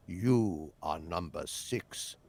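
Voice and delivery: very low, slow British interrogator; hushed, deliberate British villain; British accent. Acted. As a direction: pronounce it softly and slowly in British. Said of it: "like in the opening of The Prisoner".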